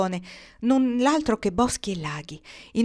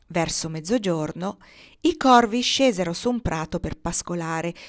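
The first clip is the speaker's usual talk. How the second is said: plain reading voice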